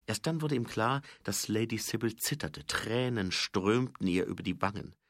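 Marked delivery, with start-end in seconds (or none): none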